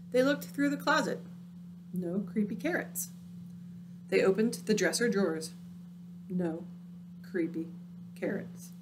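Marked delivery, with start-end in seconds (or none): 6.3-8.7 fading to a whisper. A narrator's reading. Fading eerie repetition.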